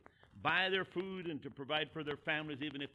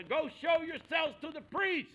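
high, slow, loud shout; hollered back; 10 semitones higher, 6 dB louder, slower than usual. Retold or imitated. Jesus calling back to the lepers, hollering. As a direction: project it across a distance. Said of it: "that he hollered back".

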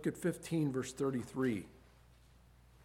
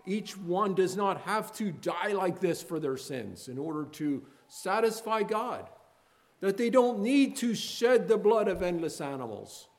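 loud, higher pleading why; impassioned preaching; 7 semitones higher, 8 dB louder than usual. Acted. Preacher asking the congregation why Jesus suffered, impassioned. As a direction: cry out each question of pain.

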